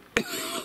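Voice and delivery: sudden coughing fit; choking cough. Real, not acted. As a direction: cough from swallowing wrong. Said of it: "j'ai avalé de travers".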